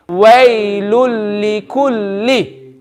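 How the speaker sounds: very slow WAYLUL LIKULLI, no nasal hum; without gunna. Shown as a demonstration. Teacher leads everyone to say it together, slow, without gunna. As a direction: stretch waylul likulli, leaning long on each doubled L. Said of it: "everyone say with me".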